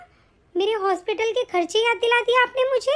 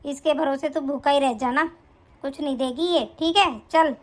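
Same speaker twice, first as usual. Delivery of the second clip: put-on lower, scolding older woman's voice; mother-in-law bossing her son's wife, lowered scolding voice; nagging mother-in-law; 7 semitones lower than usual. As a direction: berate the bahu like a harsh saas.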